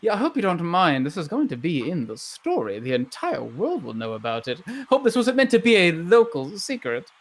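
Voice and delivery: gushing newswoman with leaping pitch; excited story-hunting reporter. Acted. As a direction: burst out high, then bubble on about the story. Plays Mary Joy Revelry, thrilled reporter.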